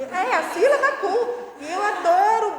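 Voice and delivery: a real laugh breaking in on "sílaba cu". Real, not acted.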